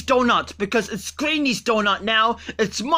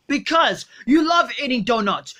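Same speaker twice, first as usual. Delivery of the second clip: ordinary talk, nothing marked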